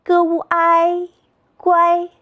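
"g u i 乖" chanted high and level, g, ū, āi, then guāi; three-part blend "g u i 乖", all first tone; pinyin blending. Shown as a demonstration. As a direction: sound out "g u i", then join as "乖" on one high note. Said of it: "再读一遍".

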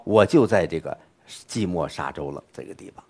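ordinary talk, nothing marked

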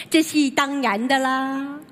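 put-on slow, drawn-out Cantonese-accented Mandarin. Shop owner answering a Shandong customer, in a drawn-out Cantonese accent. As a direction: put on a Guangdong shopkeeper's accent. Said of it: "广东话".

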